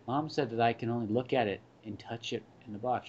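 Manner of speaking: put-on soft, subdued kid voice; small, quiet child repeating Mom's rule; dejected little kid. Kid in toy aisle wanting Lego, subdued resignation.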